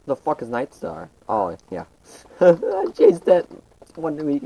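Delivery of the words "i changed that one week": laughing. Real, not acted. Laughs at his own rename.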